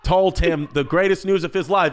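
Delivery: usual speaking voice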